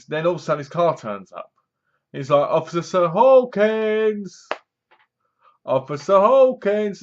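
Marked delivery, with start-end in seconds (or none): 2.5-7.0 high, drawn-out mock holler; imitated calling out. Man calling the officer as his car turns up, imitated calling out. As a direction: stretch the name out as if hailing someone.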